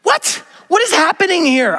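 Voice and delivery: very high, stunned "What?"; shocked. Retold or imitated. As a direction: total bewilderment. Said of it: "I'm like".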